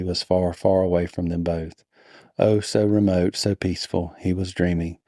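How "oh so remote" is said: ordinary delivery, nothing marked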